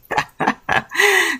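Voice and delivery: a quick bright laugh up high; high short laugh. Real, not acted. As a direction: laugh out at your own joke. Laughs at own joke.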